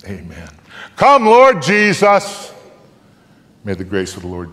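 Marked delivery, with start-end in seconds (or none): 1.0-2.5 high, loud and slow cry; fervent plea. Acted. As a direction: stretch it out as an earnest appeal. Final prayerful plea in reading.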